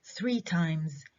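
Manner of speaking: three stressed, times reduced. Shown as a demonstration. Teacher answering with the number stressed. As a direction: hit three, let times fall. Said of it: "اللي أخذ الستريس هو العدد".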